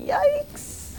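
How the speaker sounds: surprised yelp. Real, not acted. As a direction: jump at it in alarm. Reacting to embarrassing email.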